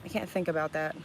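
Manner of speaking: ordinary talk, nothing marked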